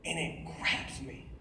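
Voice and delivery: soft, low dramatic narration; hushed storytelling. A narrator's reading. Storyteller recalls sinking and being rescued, soft hushed narration. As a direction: bring it down to a solemn hush.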